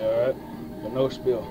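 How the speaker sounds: normal talking voice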